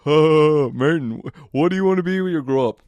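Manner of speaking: loud, high teasing lad voice; mocking older lads. Older lads teasing young Martin, loud mocking call. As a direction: call out like a jeering teenager. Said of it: "the older lads would be like".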